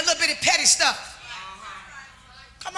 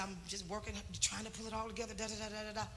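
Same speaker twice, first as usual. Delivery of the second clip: tired mumble; 8 semitones lower, 22 dB quieter, faster than usual. Retold or imitated. Husband voiced, tired and dismissive.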